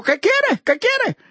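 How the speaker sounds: very high-pitched, insistent question; acted high-pitched demand; calling out a question. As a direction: call it out high, twice over. Jesus calling to blind man.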